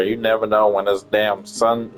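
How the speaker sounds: normal talking voice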